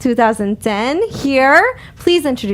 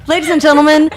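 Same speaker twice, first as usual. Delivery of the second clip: louder, playful announcer call to the audience; laughing show announcer; 2 semitones higher, 6 dB louder than usual. Acted. Host introduces the show to listeners, loud playful announcer.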